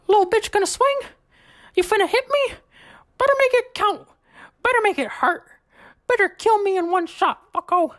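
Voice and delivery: high squeaky taunting challenge; Mickey Mouse voice. Retold or imitated. Mickey daring the new guy to swing, high squeaky taunt. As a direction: goad him in a shrill cartoon mouse squeak. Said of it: "this is verbatim, word for word".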